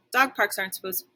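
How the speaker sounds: usual speaking voice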